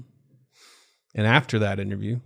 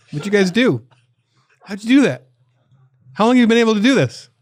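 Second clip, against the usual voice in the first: much higher, louder incredulous questioning; mock astonishment; 11 semitones higher, 6 dB louder than usual. Caller grilling the Ninth Circuit, mock astonished. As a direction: build in hammed-up disbelief. Acted call, demanding answers from court.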